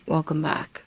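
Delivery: ordinary delivery, nothing marked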